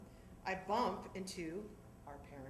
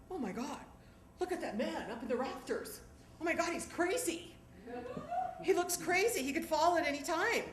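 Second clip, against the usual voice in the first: alarmed onlooker; 10 semitones higher, 5 dB louder than usual. Retold or imitated. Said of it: "Woman sitting next to us says".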